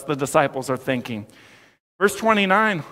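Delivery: ordinary delivery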